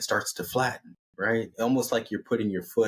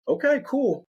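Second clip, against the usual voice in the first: chirpy character voice. Acted. Quoted character reply.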